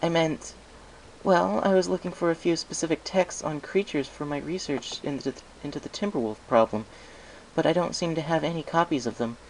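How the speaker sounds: lightly voiced eager scholar; Twilight's voice. Twilight explains her research.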